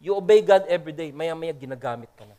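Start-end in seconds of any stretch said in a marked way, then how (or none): none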